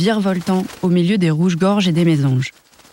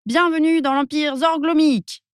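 much higher, grand welcome; proud cry; 10 semitones higher than usual. A narrator's reading. Adélie Dello welcomes them to her empire, high proud cry. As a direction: announce the empire with delight. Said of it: "s'écria Adélie Dello".

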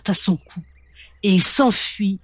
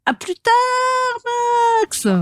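calling hen. Acted. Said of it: "quand elle crie".

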